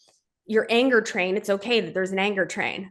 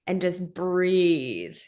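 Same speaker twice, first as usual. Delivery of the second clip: calm and slow; much slower than usual. Shown as a demonstration. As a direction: ease them into a breath. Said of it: "give yourself sixty seconds".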